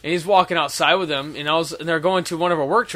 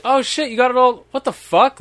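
mock surprise; 7 semitones higher, 4 dB louder than usual. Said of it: "and he's like".

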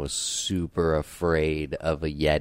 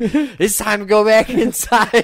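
high, loud and slow, spooked; scared; 11 dB louder than usual. Retold or imitated. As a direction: hurry everyone inside, frightened. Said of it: "We're like".

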